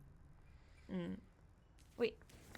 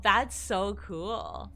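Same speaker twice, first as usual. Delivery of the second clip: ordinary talk, nothing marked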